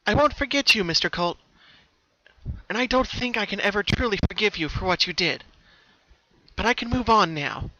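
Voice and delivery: put-on high, lively young pony voice; Pinkie voice. Pinkie, resolved, speaking to herself at the door, high and lively.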